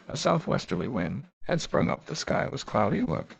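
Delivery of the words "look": plain reading voice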